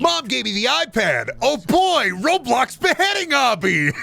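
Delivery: high, excited mock child voice; whiny iPad kid. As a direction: squeal it like a screen-hungry kid. Mimics an iPad-obsessed child.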